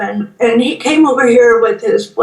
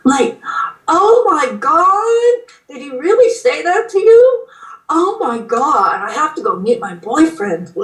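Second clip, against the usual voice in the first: put-on Valley Girl accent; 5 semitones higher than usual. Shown as a demonstration. Showing a friend the accent on request, hammed-up Valley Girl. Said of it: "Another one is a Valley Girl accent. Can you do that one?".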